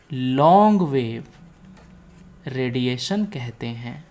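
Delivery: ordinary delivery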